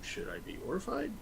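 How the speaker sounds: high, soft, hesitant wondering; mock a tentative inner question; unsure onlooker. Amorous couple wondering how to react, unsure onlooker. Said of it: "like they're thinking".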